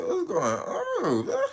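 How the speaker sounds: slow, high, drawn-out confusion; slow high bewildered question; dazed confusion. Retold or imitated. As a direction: stretch it out like waking up dazed. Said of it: "I'm just like".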